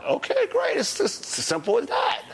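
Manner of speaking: sarcastic. Real, not acted. Sarcastic reply ending the cigarette spat.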